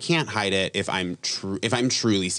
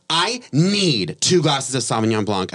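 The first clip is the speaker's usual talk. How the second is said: higher, louder and slow, an entitled order; demanding customer; 6 semitones higher, 5 dB louder, slower than usual. Retold or imitated. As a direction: play the pushy brunch woman at the bar. Rude customer at busy brunch.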